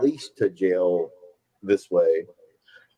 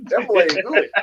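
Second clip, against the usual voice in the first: put-on old barbershop man; 8 semitones higher than usual.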